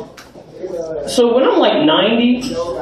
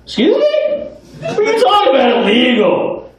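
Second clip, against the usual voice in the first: much higher, fast, squeaky outraged senior; indignant old man; faster than usual. Pretend. Old man scolding the officer, high and fast with indignation. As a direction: shriek back like a cranky old geezer. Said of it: "it might go a little something like this".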